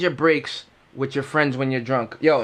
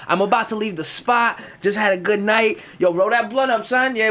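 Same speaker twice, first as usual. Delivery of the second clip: ordinary delivery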